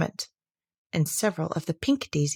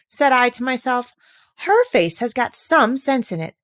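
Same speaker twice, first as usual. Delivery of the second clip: high, louder prim flower voice; a prim rose quoting herself; fussy rose; 6 semitones higher, 5 dB louder than usual. Acted. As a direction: play the prissy rose musing aloud. Rose quoting her own thought.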